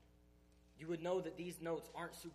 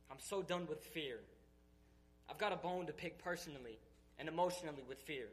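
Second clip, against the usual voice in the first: building, louder fervent preaching; full-force preaching; 4 dB louder than usual. Acted. Speaker to his church, declaring he is done with fear, in building preaching. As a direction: pour it out like a revival sermon, climbing.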